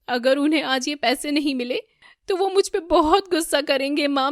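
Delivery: loud, high, distressed pleading; distraught panic. A narrator's reading. Son tells mother he may lose his job, distraught.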